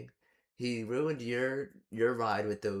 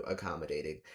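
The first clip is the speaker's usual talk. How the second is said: dry sarcastic comment; 6 semitones lower, 3 dB quieter than usual. Real, not acted. Sarcastic label after quote.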